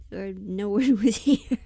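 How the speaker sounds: bursting into a loud laugh mid-line. Real, not acted. Streamer laughing at her silent chat, laughing loudly.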